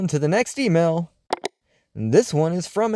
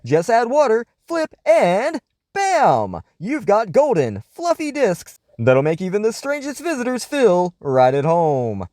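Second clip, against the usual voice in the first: sponsor ad read; 5 semitones higher than usual. Acted. Host reading a Bisquick ad to his listeners, upbeat ad voice. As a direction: sell the pancakes like a radio huckster.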